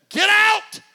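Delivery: high, loud shout of Get out; preacher shouts mercy's order; shouted command. Acted. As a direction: bark it at full force.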